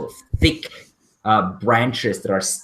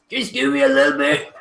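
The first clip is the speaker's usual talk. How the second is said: ogre voice; 13 semitones higher, 3 dB louder than usual. Acted. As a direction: answer like a nervous hulking ogre. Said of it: "the ogre looks up and says".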